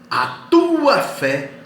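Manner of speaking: high, loud and very drawn out; forceful slow preacher's quotation of Jesus; emphatic proclamation. Retold or imitated. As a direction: proclaim it, holding TUA long at the peak. Preacher repeats words of Jesus.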